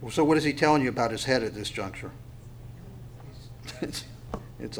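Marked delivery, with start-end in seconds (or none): none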